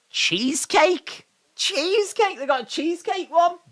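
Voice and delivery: high, gasping surprise; excited gasp. Real, not acted. Gasps at cheesecake flavor.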